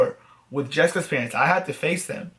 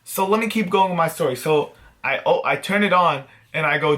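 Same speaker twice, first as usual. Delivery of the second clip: normal talking voice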